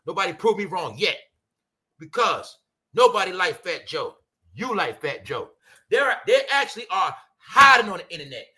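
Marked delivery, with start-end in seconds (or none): none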